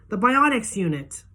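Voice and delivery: boze, trage beschuldiging. A narrator's reading. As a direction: spell it out angrily, slow. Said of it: "I say".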